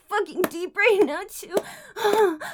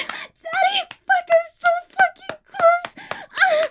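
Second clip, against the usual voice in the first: much higher, crying out the word Daddy; 12 semitones higher than usual. Acted. Streamer to partner, crying Daddy out high. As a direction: cry Daddy out at the peak. Building to climax.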